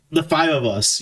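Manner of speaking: ordinary talk, nothing marked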